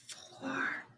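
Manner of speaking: breathy whispered number. Shown as a demonstration. Teacher whispering every other number in a counting drill, whispered. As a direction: whisper it, no voice at all. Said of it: "Now we're gonna whisper every number".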